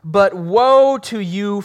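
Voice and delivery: loud, slow, drawn-out woe; proclaiming woe. Acted. Pastor voicing Jesus rebuking the Pharisees, loud and slow. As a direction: call down judgement on them.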